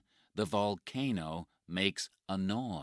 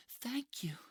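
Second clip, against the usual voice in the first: high young woman's voice, fully voiced thank you; grateful high-voiced thanks. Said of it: "she says to Mike".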